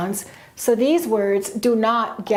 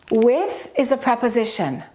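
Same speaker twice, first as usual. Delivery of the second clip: normal talking voice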